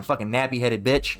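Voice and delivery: ordinary talk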